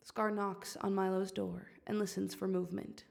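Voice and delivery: ordinary delivery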